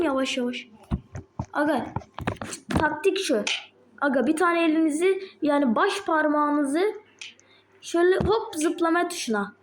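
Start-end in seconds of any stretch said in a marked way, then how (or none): none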